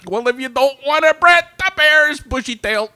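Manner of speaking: loud, high Chicago guy; hammed-up Chicago accent as Brian.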